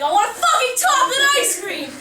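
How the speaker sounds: high, loud exasperated yell; hammed-up drive-through outburst; mock angry shout. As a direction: yell the order like a fed-up prankster. Acted outburst at drive-through speaker.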